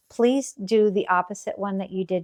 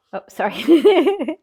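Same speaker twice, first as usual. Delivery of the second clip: laughing; 8 semitones higher, 10 dB louder, slower than usual. Real, not acted. Dog interrupts, laughs.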